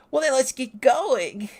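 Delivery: high, loud, drawn-out cheer; rally them in a loud cartoon voice; acted rallying cry. Companion forgiving and setting off, loud rallying cry.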